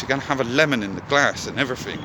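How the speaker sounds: normal talking voice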